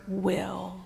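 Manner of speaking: "will" isolated and said softly with weight; soft, deliberate "will"; stressed word. Shown as a demonstration. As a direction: set "will" apart, quiet and deliberate. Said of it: "we put emphasis on that word".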